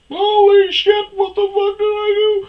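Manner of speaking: very high, loud mock shriek; panicked cartoon squeal. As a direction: squeal it out as a startled little creature. Imitates startled Chico mid-leap.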